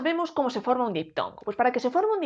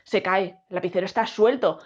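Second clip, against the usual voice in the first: ordinary talk, nothing marked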